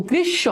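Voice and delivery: গ্রীষ্ম said "grish-sho", m silent; doubled sh. Shown as a demonstration. Teacher giving the next example, গ্রীষ্ম as "grish-sho". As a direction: hold the sh in গ্রীষ্ম. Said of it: "শয়ের উচ্চারণ দুইবার পাচ্ছ".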